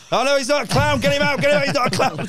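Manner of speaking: high, loud panicked yelling; panicked shout. Retold or imitated. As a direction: yell to get him out, high and loud. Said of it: "I go".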